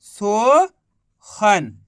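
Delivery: very slow, stretching every sound of Sohan; very slowly joining the syllables of Sohan; slow drawn-out blend. Shown as a demonstration. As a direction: stretch Sohan out so kids hear it join up. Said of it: "मिलके बन गया".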